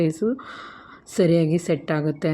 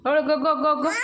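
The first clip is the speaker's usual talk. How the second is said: laughing hurry; 9 semitones higher, much faster than usual. Real, not acted.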